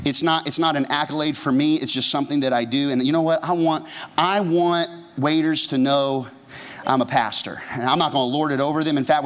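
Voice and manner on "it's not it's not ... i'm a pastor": normal talking voice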